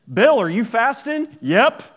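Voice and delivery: loud, high-pitched comic call; mock loud exchange. Acts out hypocrite publicly asked.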